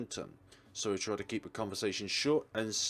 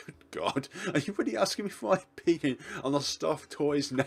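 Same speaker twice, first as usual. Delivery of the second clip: amused disbelief rising high and loud; laugh, then protest incredulously; laughing exasperation; 7 semitones higher, 5 dB louder than usual. Acted. Asked to judge a stuffed toy's name, laughing exasperation.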